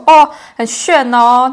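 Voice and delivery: loud, very slow drawn-out compliment; mock praise. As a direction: draw out the fake admiration. Imitating relatives' remark. (拉长声音夸张称赞)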